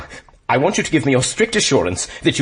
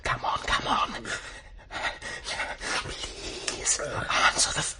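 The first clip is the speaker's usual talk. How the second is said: whispered; 2 semitones higher, 8 dB quieter, much slower than usual. Acted. Muttering impatiently waiting at payphone.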